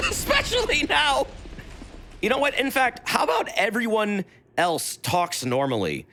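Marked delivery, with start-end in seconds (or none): 0.1-1.3 mock shout. Shown as a demonstration. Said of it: "repeated louder".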